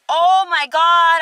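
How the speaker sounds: loud, slow, high oh my God; drawn-out gasp of disgust; reacting to her friend's confession; shocked. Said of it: "And I was like".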